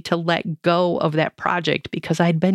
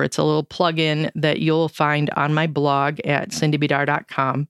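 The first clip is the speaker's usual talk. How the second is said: ordinary talk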